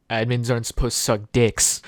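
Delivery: ordinary delivery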